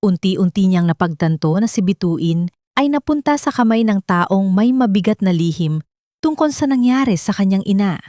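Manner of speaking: plain reading voice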